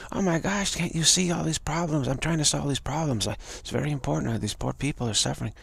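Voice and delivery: desperate to make a friend see the suffering; anxious pleading. Acted. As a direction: dreamer pleading with friend, high and urgent. Dreamer pleading about the problems.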